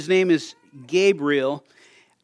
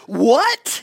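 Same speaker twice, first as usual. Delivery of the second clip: shocked what; 7 semitones higher, 5 dB louder than usual. Retold or imitated. His shocked reply to daughter.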